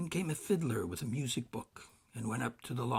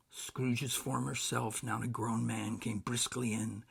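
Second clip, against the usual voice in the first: ordinary delivery, nothing marked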